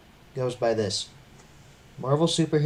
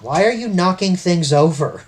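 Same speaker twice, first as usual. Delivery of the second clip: loud, raised-pitch complaint; loud exasperated question; exasperated scolding; 6 semitones higher, 9 dB louder than usual. Real, not acted. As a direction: call out the clumsiness, loud and high. Scolding partner for knocking things.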